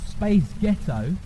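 lightly put-on American accent. Shown as a demonstration. Showing an audience how accents blend, in an American accent. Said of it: "in an American accent".

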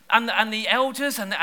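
normal talking voice

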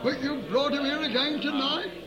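high, ringing preacher's prayer; fervent prayer. Real, not acted. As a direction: pour it out to God at full force. Preacher praying at full force.

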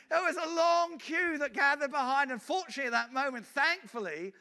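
high, loud and excited, near shouting; full-force preaching. Acted. Preacher telling a crowd about the revival, excited and loud. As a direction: let the revival story burst out of you.

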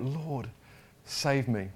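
normal talking voice

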